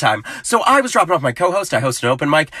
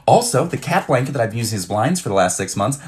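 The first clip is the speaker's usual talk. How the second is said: normal talking voice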